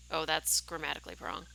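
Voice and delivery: ordinary talk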